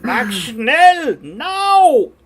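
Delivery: loud, high-pitched German caricature shout; barking German accent. Shown as a demonstration. Streamer demonstrating the wrong kind of German accent, shouted German. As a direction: bark orders in a hammed-up German accent. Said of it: "it's a German accent, but it's not the".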